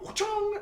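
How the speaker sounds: high squeaky wachong; silly battle cry. Pretend. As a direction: yelp it like a cartoon hero. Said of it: "you look at him and you go".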